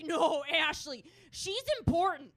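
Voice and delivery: loud, high, drawn-out dad impression; exasperated dad. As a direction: wail the correction like a fed-up father. Acts out dad's exasperated comeback.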